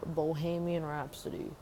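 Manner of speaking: ordinary talk, nothing marked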